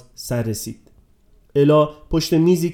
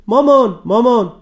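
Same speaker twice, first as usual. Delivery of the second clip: shouting; 8 semitones higher, 6 dB louder than usual. A narrator's reading.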